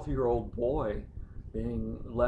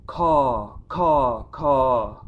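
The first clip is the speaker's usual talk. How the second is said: crow cries; 3 semitones higher, 9 dB louder, much slower than usual. Pretend. Poet calling out like the crows over the cemetery, loud cawing. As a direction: shriek it out like crows over graves.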